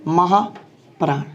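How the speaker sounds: महाप्राण drawn out very slowly, falling at the end; slow repeat. Shown as a demonstration. Teacher repeating महाप्राण slowly for students. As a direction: stretch महाप्राण long for the class to copy. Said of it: "दूसरा हो गया हमारा महाप्राण".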